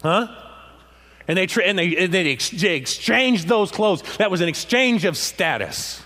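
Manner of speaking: loud, driving preacher's cadence; full-force preaching. Acted. Preacher to his congregation, loud and driving.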